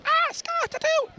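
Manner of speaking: high-pitched mock panic; retold daughter's cry. Daughter calling out clues, high mock panic. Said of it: "She goes".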